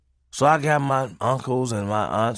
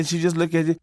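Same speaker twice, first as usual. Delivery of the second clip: ordinary talk, nothing marked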